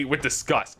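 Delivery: ordinary talk, nothing marked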